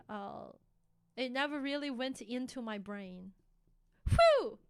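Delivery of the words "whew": whoosh sound. Real, not acted. Joke about it going over her head.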